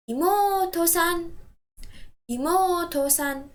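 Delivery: "Imoto-san" slow, with a long mo; stress on mo. Shown as a demonstration. Teacher modelling the polite form, slow with long "mo" in "Imoto-san". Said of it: "Stress the syllable mo".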